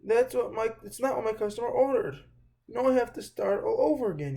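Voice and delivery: upset owner scolding George, agitated; angry shopkeeper. Acted. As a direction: scold with rising frustration. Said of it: "Mister Herb was very angry".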